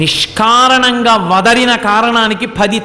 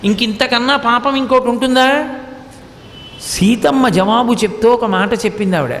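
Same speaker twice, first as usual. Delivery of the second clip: usual speaking voice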